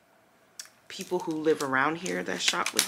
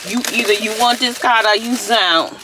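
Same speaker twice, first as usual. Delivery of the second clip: louder, no-nonsense take it or leave it; sassy ultimatum; 5 semitones higher, 11 dB louder than usual. Retold or imitated. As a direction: lay down the rule, loud. Repeats the ultimatum.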